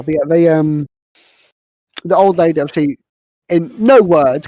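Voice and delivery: usual speaking voice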